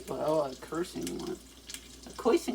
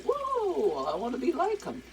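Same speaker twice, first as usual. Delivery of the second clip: mock awed fan; 8 semitones higher than usual. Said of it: "it's just like".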